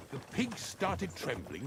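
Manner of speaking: usual speaking voice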